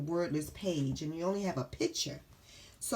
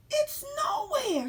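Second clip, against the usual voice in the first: very high, slow whimpering cry; 5 dB louder, slower than usual. Acted. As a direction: wail that it's nowhere. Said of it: "he says".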